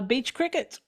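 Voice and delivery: ordinary talk, nothing marked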